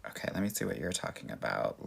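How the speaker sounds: ordinary delivery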